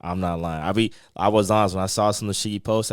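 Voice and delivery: ordinary talk, nothing marked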